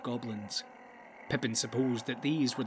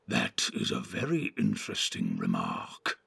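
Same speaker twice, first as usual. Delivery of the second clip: very low, sinister orc voice; soft, low, evil creature; evil sneer. A narrator's reading. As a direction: sneer softly, full of menace. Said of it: "sneered a voice, softer than the others but more evil".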